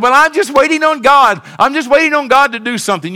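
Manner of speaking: hammed-up passive believer; mock complaining believer. Pastor mocking someone who just waits on God. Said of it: "Somebody said".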